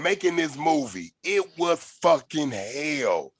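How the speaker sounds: ordinary talk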